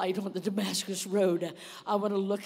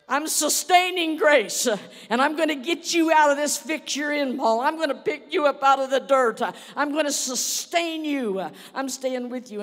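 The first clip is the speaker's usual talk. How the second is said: sustaining grace voice; 4 semitones higher, 8 dB louder than usual. Acted. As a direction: declare it like a mighty rescuer. Grace sister speaks to Paul.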